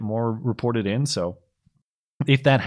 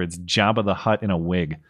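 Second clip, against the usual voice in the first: usual speaking voice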